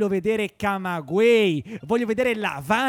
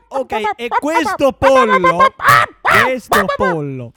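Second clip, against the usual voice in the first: slow, loud build on pollo; drawn-out loud emphasis; emphatic; 6 semitones higher, 9 dB louder, slower than usual. Acted. Ranting about the one chicken.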